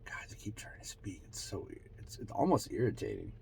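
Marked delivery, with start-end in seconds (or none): 0.1-2.3 very soft, near-whispered mutter; muttered aside. Real, not acted. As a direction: mutter it under your breath to yourself. Muttered aside about the voices.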